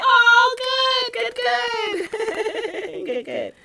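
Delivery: high, gushing delight; real squeal of pleasure; delighted relief. Real, not acted. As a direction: coo it high, tipping into a laugh. Pleased at news from a viewer.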